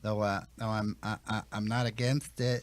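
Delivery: meek mumble. Acted. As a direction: mumble it meekly under your breath. Victim murmurs meekly.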